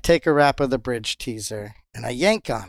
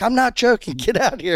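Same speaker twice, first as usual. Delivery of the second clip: high, insistent retold yell; 10 semitones higher, 4 dB louder than usual. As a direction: holler for a doubting mate to come. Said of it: "I'm, like, yelling".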